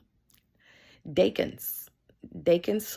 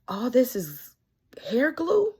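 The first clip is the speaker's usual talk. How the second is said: disbelieving, higher and louder on glue; doctor can't believe how much glue there is; amazed question; 6 semitones higher, 6 dB louder, slower than usual. Retold or imitated. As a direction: let the disbelief leap up.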